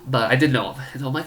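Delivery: usual speaking voice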